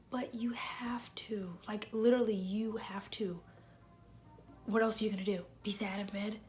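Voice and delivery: whisper. Real, not acted. Urging viewers to go outside.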